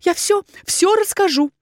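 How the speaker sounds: high, anxious promise; hasty frightened surrender. A narrator's reading. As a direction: give in quickly, voice pitched high with fear. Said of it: "быстро зашептала Валерита".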